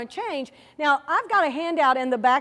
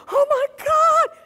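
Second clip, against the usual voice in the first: much higher, slow, stretched-out squeal of shock; a baby bird suddenly dropped mid-air, hammed-up terror; panicked eaglet; 13 semitones higher, 7 dB louder, slower than usual. The eaglet after his mother drops her wings, panicking high. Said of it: "And he's like".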